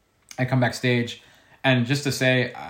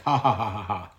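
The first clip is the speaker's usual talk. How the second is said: spelt-out fake ha-ha, falling away; imitated laughter. Friends laugh at the funny story, mock laughter dropping off. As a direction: act out friends laughing, not really amused. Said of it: "and it was just like".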